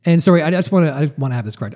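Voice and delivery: ordinary talk, nothing marked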